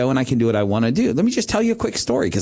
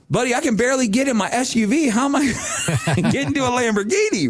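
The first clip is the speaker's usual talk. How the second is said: laughing quip; 10 semitones higher, faster than usual. Retold or imitated. Warren's joke about Lamborghini.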